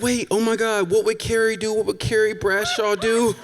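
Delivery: high-pitched mock-panicked fretting; retold panic. Host thinking as the romantic moment goes wrong, high-pitched mock panic. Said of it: "And so I'm panicking because".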